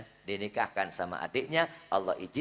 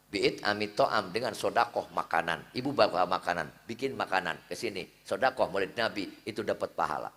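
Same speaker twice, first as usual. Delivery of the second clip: ordinary talk, nothing marked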